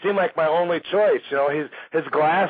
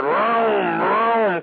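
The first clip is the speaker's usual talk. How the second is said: imitated truck lurching forward, pitched up; drawn-out vrooms, higher than speech; imitated engine revving; 4 semitones higher than usual. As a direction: make revving truck noises.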